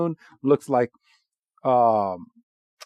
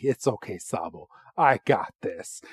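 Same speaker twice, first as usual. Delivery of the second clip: low, soft, cool protector voice; gentle reassurance; 7 semitones lower, 6 dB quieter than usual. Retold or imitated. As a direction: imitate a calm hero comforting a little one. Voices Inteleon reassuring Sobble.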